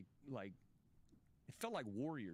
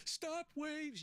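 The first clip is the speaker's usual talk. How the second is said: high, cracking tearful cry; crying yell; 3 dB louder than usual. Retold or imitated. On the beach, yelling at the waves at sponsor's urging, high tearful yell. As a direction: wail it at the sea through tears. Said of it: "and then I'm crying and I'm".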